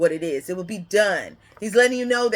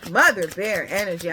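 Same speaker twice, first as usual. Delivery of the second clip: ordinary talk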